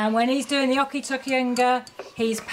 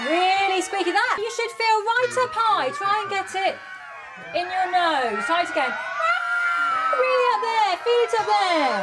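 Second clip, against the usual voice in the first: very high, nasal-placed squeak; squeaky high voice; 9 semitones higher, 3 dB louder than usual. Shown as a demonstration. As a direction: push it up into your nose. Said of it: "really squeaky high Baby Bear".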